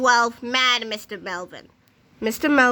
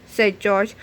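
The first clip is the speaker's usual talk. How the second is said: usual speaking voice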